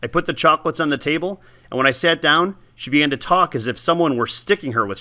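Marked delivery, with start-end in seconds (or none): none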